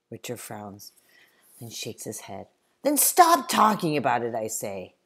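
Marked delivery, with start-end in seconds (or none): 2.8-4.4 loud, higher irritated command; angry loud end to it; sharp order. Acted. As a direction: shut the topic down hard. Samuel ends the argument.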